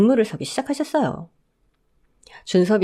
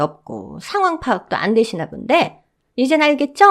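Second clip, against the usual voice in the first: mother-in-law insisting she is the owner; indignant insistence; 4 semitones higher than usual. Acted. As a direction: hammer the point home, demanding.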